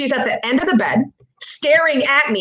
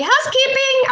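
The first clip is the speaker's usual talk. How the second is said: very high, singsong maid call; housekeeping lady. Retold or imitated. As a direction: mimic housekeeping announcing herself at the door. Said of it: "it sounded like the lady".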